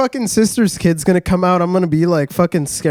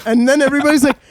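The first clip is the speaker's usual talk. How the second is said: ordinary talk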